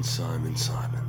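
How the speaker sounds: whispering. Acted. Whispered name in dark.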